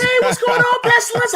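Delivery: mock a tiny high voice to tease; high-pitched fake voice. Joking about the host's fake voice, squeaky high falsetto. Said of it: "a high-ass pitch voice".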